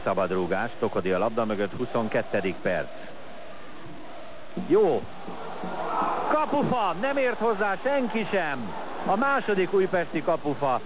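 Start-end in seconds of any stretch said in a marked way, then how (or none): 4.7-10.8 very high cry of kapufa; excited sports commentary. Real, not acted. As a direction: shout as the ball hits the post. Shot hits the post.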